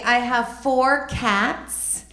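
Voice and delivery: ordinary talk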